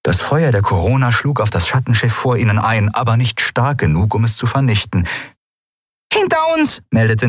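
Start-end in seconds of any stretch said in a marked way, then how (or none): 6.1-6.8 very high, urgent call; shouted warning. A narrator's reading. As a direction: cry out a warning to the crew. Lookout warns crew in battle. (hoch gerufene Warnung)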